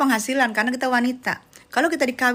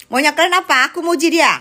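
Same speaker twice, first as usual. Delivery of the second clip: higher and louder, demanding what they want; exasperated outburst; 6 semitones higher, 7 dB louder than usual. Acted. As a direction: throw the question at the viewers, fed up. Repeats louder to viewers. (teriak kesal pura-pura: maunya kalian apa)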